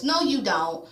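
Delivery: ordinary delivery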